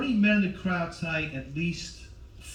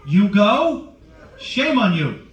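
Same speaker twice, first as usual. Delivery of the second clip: loud, raised mock outrage; mock scolding; 5 semitones higher, 8 dB louder, much slower than usual. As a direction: shame the audience in a big voice. Mock-scolding audience over Olive Garden.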